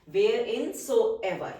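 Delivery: very drawn-out, syllable by syllable; slow dictation. Shown as a demonstration. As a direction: stretch it out for the class to write. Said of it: "teacher dictates word slowly". (बहुत धीमे खींचकर बोलना)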